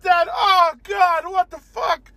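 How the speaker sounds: high, loud shell-shocked ant cry. Acted. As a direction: cry out like an ant seeing its dead family. Ants see dead relatives.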